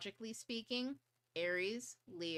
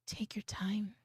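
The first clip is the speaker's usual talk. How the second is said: slow chill whisper; 3 semitones higher, slower than usual. Shown as a demonstration. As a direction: whisper it gently to calm an overwhelmed student. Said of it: "real slow and real chill".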